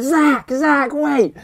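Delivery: high, loud shout after a friend ahead; calling out. Retold or imitated. Chasing his friend down the dark road, shouting for him to wait. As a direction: yell to stop him leaving you behind.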